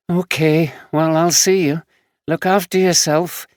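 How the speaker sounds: caring friend, a bit weary; young homeless woman. Acted. Samantha voiced.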